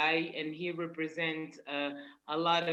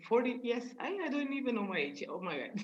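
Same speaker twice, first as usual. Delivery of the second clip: usual speaking voice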